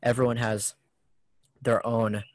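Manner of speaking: ordinary talk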